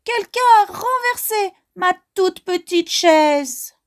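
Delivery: high little bear cry. Acted. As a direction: squeal it like the smallest cub. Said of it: "crie de sa petite voix perçante".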